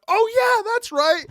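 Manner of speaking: very high, delighted realization; light up as it clicks; high realizing quote. Retold or imitated. Stranger recalling Aquaman, very high and delighted. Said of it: "And then she went".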